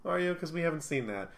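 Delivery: doubtful, high-pitched mock questioning; lilting disbelief, as if unconvinced; sceptical. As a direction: challenge the claim, pitch jumping up. Acted sceptical reply to a claim.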